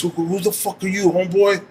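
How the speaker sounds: mock a homeboy sizing you up; high-pitched retold challenge; confrontational challenge. Imitates the man challenging him again.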